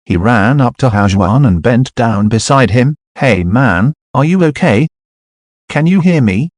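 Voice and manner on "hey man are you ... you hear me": put-on worried young man checking on someone. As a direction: ask anxiously if he's hurt. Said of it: "he said worriedly".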